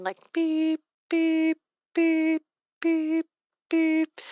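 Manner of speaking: high steady beeping dial tone. Shown as a demonstration. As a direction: mimic the old dial tone. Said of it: "The, the dial tone".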